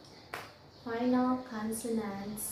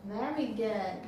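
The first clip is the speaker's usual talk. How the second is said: ordinary talk, nothing marked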